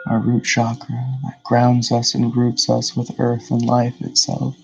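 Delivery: measured meditation guide, long pauses between phrases; guided meditation. Real, not acted.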